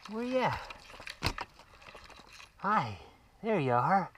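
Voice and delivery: high coaxing call to a cat; sweet high pet call. Real, not acted. Calling cat in tree.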